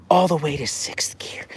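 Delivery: soft unvoiced whisper. Real, not acted. Owner whispers praise to her dog. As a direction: hush it to the dog.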